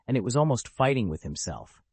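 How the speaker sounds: plain reading voice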